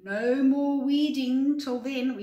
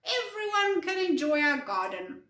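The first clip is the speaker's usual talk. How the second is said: brightly lifted, higher-pitched voice; happily inviting, pitch raised; cheerful; 6 semitones higher, 3 dB louder than usual. Acted. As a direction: say it with mild storybook cheer. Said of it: "Ginger said cheerfully".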